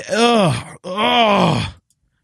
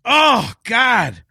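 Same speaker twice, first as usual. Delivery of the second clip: ordinary talk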